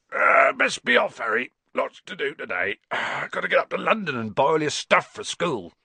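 cheerful giant waking, bursting high; hearty giant off to London; Hagrid voice. Acted. Hagrid telling Harry it's time to go, hearty.

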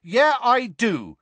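loud, slow, high defiant reply; angry. A narrator's reading. Ron stands up for Harry to Seamus, angry. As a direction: insist angrily, drawing out each word. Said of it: "said Ron angrily".

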